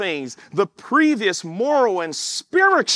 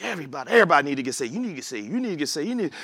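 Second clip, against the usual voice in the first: rapid preaching; 3 semitones lower, 4 dB quieter, much faster than usual. Retold or imitated. Overzealous convert at work.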